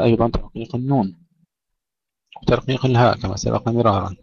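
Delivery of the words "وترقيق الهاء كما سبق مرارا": usual speaking voice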